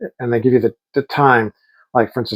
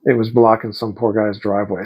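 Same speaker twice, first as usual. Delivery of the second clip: ordinary talk, nothing marked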